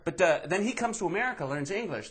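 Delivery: ordinary delivery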